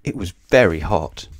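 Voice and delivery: ordinary delivery, nothing marked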